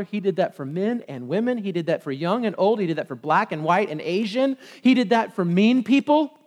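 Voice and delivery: ordinary talk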